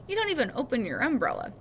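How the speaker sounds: ordinary delivery, nothing marked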